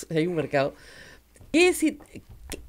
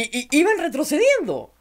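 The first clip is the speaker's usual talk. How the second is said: ordinary talk, nothing marked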